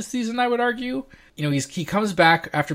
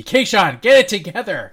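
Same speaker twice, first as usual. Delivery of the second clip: loud, higher-pitched retold yell at the TV; exasperated yell; 6 semitones higher, 8 dB louder than usual. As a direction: re-enact hollering at a useless character on screen. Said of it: "found myself just yelling at the T V".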